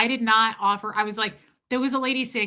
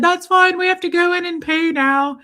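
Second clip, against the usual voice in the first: higher, louder chipper brush-off; brisk cheer; 7 semitones higher, 6 dB louder than usual. Retold or imitated. Escaping the awkward nurse, loud chipper brush-off. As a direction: cheerfully end the talk.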